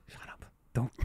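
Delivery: barely audible retold hush; hushed scolding. Mom caught out, retold.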